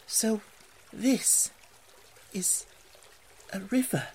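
slow, halting words with long pauses; hushed wonder. A narrator's reading. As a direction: take it in word by word, marvelling. Awestruck Mole sees river.

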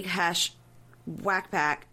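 normal talking voice

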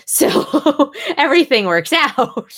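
high laughing remark. Real, not acted. Reader laughing about Saxton getting the job, high and amused. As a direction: laugh it out wryly.

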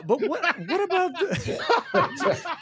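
high-pitched, breaking up with laughter; helpless laughing, words stretched out. Real, not acted. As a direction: try to ask through real giggles. Breaks up at own joke.

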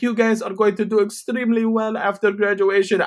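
high, put-on speech-giver voice; professor addressing graduates. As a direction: imitate a professor proclaiming to the class. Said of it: "the professor's like".

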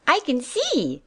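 high, thrilled little-rabbit cry; a baby bunny bursting with joy, pitched high; excited cry. Acted. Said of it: "he cried".

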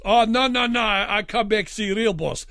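put-on high, loud generic European accent, waving her off. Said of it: "generic European accent".

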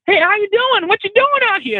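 very high-pitched cartoonish woman's voice; put-on piercingly high character voice; crazy homeless lady. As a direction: squeal it like an eccentric street lady. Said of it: "this homeless lady".

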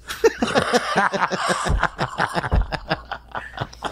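high breathless laughing fit; helpless wheezing laughter. Real, not acted. Breaks up laughing.

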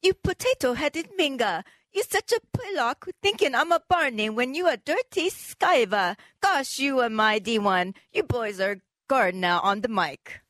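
exaggerated mock-British accent, drawn out; hammed-up British accent reading insults. Shown as a demonstration. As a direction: put on a slangy British accent. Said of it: "in with the British accent".

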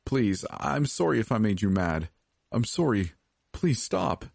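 high-pitched, desperate apology; frantic, tearful-sounding appeal; begging. A narrator's reading. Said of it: "I begged".

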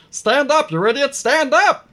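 high, loud gnome scolding; frantic gnome. Acted. Gnome slapping the dazed orc's head, high frantic scolding. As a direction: urge him up in a high gnome voice. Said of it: "slapping him in the head".

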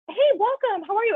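bright, high welcome of a greeter; cheery greeter. Retold or imitated. The man she was rude to greets her cheerily. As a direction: play the chirpy greeter at the door.

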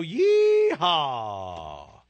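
long, drawn-out cowboy yeehaw; whooping game-show rodeo call; rodeo holler. Pretend. Rodeo catchphrase hollered by host.

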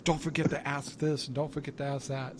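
softly quoted nagging note; retold reminder. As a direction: drop the volume to quote her reminders. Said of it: "and tells me".

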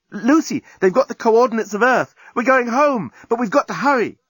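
excited friend bursting with news; Nettie's voice. Acted. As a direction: hurry her along, eager and pressing. Nettie urging Lucy home.

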